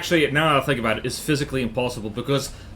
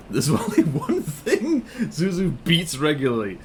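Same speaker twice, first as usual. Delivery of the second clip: laughing. Real, not acted. Laughing joke.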